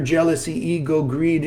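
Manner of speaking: normal talking voice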